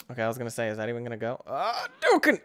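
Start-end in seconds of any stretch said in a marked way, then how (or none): 1.5-2.4 mock karate yell.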